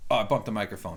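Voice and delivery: ordinary talk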